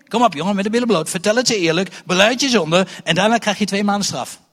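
loud, fast, bossy mock lecture; mock stern demand. Preacher mocking a strict church demanding confession, loud and bossy. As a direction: hammer the kid into confessing like a strict elder.

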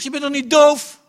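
high, loud defiant comeback; defiant retort. Retold or imitated. Preacher answering the classmate in class, loud and defiant. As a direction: throw it back in their face.

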